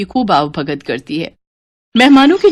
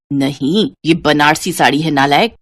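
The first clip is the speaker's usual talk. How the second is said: lower, firm nagging voice; scolding mother; 6 semitones lower than usual. Acted. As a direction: tell her off for the sari. Mother rebukes daughter. (डाँटती माँ, भारी आवाज़)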